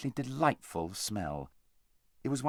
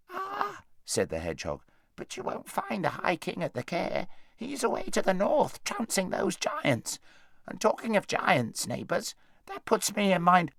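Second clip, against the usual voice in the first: hedgehog voice; 9 semitones higher than usual. Acted.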